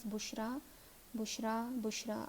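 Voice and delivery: بشرا ending in "aa" each time; same "a" ending. Shown as a demonstration. Teacher showing an unchanging word, بشرا ending in "a" thrice. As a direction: repeat بشرا identically, ending "ra". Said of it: "صرف آ کی ہی آواز".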